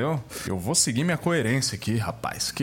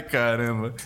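ordinary delivery

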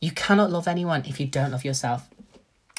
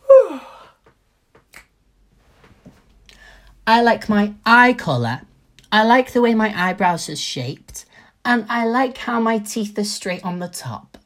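much higher and louder, hyped affirmations; pumped self-praise; 8 semitones higher, 11 dB louder than usual. Pretend. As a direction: shout your compliments to the mirror, full of joy. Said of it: "I want you to wake up like".

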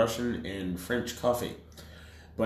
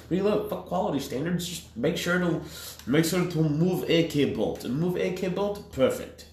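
1 mock Soviet designer; 8 semitones higher than usual. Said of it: "mock Soviet designer voice".

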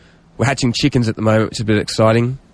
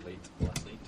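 whispered check; 21 dB quieter than usual. Real, not acted. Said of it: "Did I get it right?".

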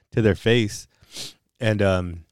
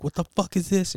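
mom's angry outburst; 11 semitones higher than usual. Retold or imitated. Mom finds hidden money.

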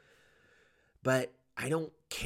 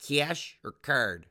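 higher, drawn-out Midwestern clerk; Metro Detroit accent; 5 semitones higher, much slower than usual. Retold or imitated. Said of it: "And they're like".

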